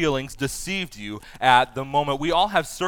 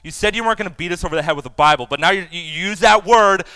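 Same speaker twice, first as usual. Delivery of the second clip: fast, louder mock protest; mock outrage; 3 semitones higher, 6 dB louder, faster than usual. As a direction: rattle off the offended listener's complaint. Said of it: "I know you're thinking".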